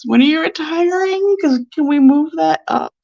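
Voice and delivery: high-pitched, playfully impatient questioning; acted eager pleading. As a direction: push the friend with mock eagerness.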